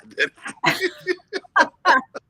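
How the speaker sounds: helpless high laugh; high burst of laughter. Real, not acted. Laughing at the boy's request.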